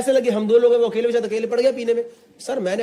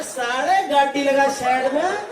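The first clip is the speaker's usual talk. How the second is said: Haryanvi threat; 3 semitones higher, slower than usual. Retold or imitated. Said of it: "कह रहा".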